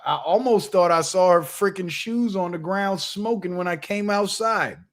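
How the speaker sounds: ordinary talk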